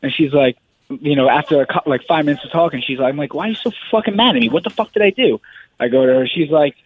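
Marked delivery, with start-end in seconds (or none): 3.3-5.4 raised-pitch, hurried retold protest; retold exasperated protest. Fighter retelling his protest to his angry wife, exasperated and high. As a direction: replay a baffled argument, pitched up.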